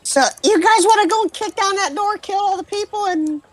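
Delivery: high, squeaky nervous goblin. Acted. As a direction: pitch way up, a timid little goblin. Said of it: "your goblin voice".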